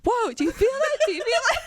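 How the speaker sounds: high, laughing "do you feel that"; mock alarmed insisting. Partner fakes an earthquake in bed, laughing mock alarm. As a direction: play up fake alarm, laughing.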